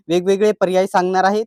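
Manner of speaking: normal talking voice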